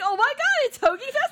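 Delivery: high, gushing fan squeal; mock excited. Replaying the cohost's reaction to Hoagie Fest, excited. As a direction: imitate someone thrilled to bits. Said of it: "got so excited".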